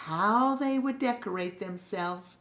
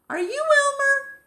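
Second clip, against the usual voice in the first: very high, louder squeaky question; puzzled question; 7 dB louder, faster than usual. Acted. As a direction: ask in a high confused squeak. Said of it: "began to ask one another".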